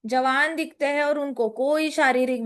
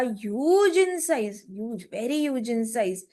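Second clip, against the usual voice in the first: stretched out slowly; much slower than usual. Acted. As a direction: drag out the huge very slowly. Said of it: "इतना बड़ा".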